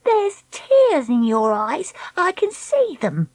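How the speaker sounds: slow, high singsong taunt; jeering. Acted. Treacle pointing out Dakota's tears, jeering.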